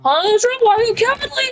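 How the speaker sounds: high, quick teasing jab; mocking, high-pitched pestering; taunting question. Taunting Ezra, repeating the question.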